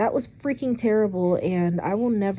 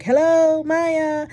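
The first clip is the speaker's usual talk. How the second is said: calling out; 9 semitones higher, 5 dB louder, much slower than usual. Retold or imitated. Retold calling out in garage.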